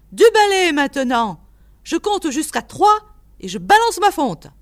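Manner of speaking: loud, high-pitched ultimatum; furious yelling. A narrator's reading. Old man at the window orders visitor off, yelling. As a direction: yell a last warning at the intruder. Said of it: "hurla monsieur Sherman".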